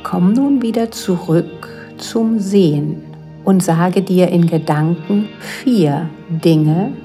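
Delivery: ordinary talk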